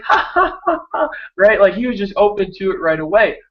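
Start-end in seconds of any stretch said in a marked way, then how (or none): 0.0-1.1 very high little giggling ha-has; imitated toddler laugh. Dad imitating his one-year-old joining in, tiny high giggle. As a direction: mimic a baby's laugh.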